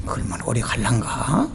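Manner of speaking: low, soft doubtful musing; skeptical aside. Retold or imitated. Repeating skeptical thought. (낮고 작게 의심하며)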